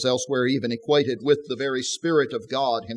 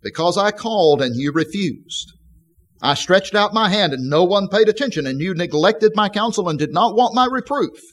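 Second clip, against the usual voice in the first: raised, insistent call, higher pitch; Wisdom calling out; 7 semitones higher, 3 dB louder than usual. Shown as a demonstration. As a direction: call it out like Wisdom in the street square. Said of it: "she was shouting in the street and lifting her voice".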